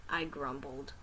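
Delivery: ordinary delivery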